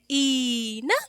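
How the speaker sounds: ई ना with the ई drawn out; long ई. Shown as a demonstration. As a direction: draw out the ई slowly. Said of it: "थोड़ा time लेके इसको बोलते हैं".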